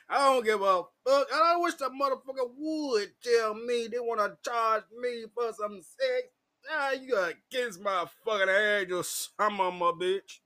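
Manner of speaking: loud, high furious rant; angry rant. Retold or imitated. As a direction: tell him off at full volume.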